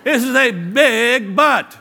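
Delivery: BIG drawn out high on "big but"; high, slow, weighty stress on "big but"; emphatic big but. Shown as a demonstration. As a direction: stretch the word "big" slowly before "but". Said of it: "I call it the big but".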